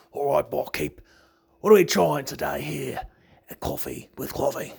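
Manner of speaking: light put-on Australian customer voice; hint of Australian, hailing the barkeep; playful Australian accent ordering at the bar. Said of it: "Sometimes Australian coffee comes out to play".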